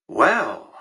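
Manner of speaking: ordinary talk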